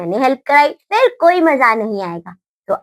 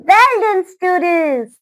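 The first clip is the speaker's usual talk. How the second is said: ordinary talk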